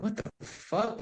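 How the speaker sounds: high, hushed what the fuck; shocked disbelief. Retold or imitated. After hugging her on the beach, hushed and stunned. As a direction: gasp it out, caught off guard.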